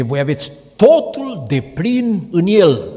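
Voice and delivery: slow, loud, stress on "totul"; emphatic proclamation. Acted. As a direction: lean hard on "totul", drawn out. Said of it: "Auzi, tot". („totul” apăsat, rar și tare)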